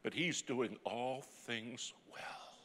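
he's doing all things well drawn out very slowly; slow emphatic. Acted. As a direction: stretch each word for weight. Sermon climax repeating mother's words.

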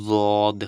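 দদ said loud and drawn out from the tongue's side edge; Arabic letter dad. Shown as a demonstration. Teacher modelling দদ for students, loud and deliberate. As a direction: model দদ clearly for learners, tongue's side against the upper molars. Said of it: "একটু try করুন".

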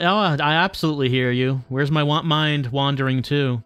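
ordinary talk

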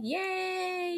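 a high Jamaican yay from her friend; Jamaican cheer. Retold or imitated. Her friend cheering the news, Jamaican-style yay. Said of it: "in her Jamaican vernacular".

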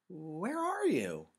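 long, drawn-out searching call; high, slow, stretched holler. A narrator's reading. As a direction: call loudly for a hidden friend. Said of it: "he shouted, looking around".

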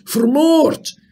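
very high, loud shocked shout; horrified cry. A narrator's reading. Horrified shout. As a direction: innkeeper shrieks the word in horror. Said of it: "riep de waard".

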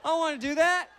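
high, loud panicked no; alarmed refusal. Retold or imitated. Husband refusing to film wife's insides, loud alarm. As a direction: recoil from the idea, shouting.

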